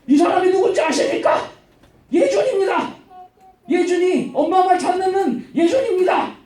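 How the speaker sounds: high-pitched mock proclamation; crying out. Slave girl follows a pupil everywhere proclaiming him, crying out. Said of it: "뒤에서 계속 따라다니면서 외치는데".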